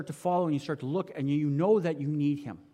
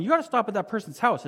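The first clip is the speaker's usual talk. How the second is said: raised and higher, pressing harder; mild mock shout of "You gotta stop at that person's house"; louder insistent inner voice; 6 semitones higher, 4 dB louder than usual. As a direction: urge him like a nudge that won't quit. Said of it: "except it's louder this time".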